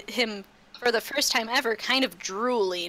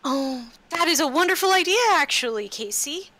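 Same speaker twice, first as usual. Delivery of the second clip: higher, louder, fast and eager; bubbly eager approval; full-hearted agreement; 7 semitones higher, 4 dB louder than usual. Acted. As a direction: gush with delight at the plan. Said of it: "saying full-heartedly".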